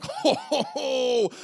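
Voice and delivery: high, loud mocking "ho, ho, ho"; mocking laugh. The angry crowd mocks Stephen with a loud jeering laugh. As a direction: jeer at him with a big fake laugh.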